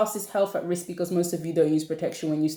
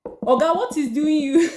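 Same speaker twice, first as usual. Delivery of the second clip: high, louder mock-baffled question; incredulous questioning; 6 semitones higher, 4 dB louder than usual. Confronting a man who can't control himself, incredulous. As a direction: challenge him, high and incredulous.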